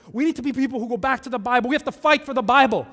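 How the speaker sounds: hammed-up passionate churchgoer; mild mock passionate yell. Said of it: "they'll yell at me about this".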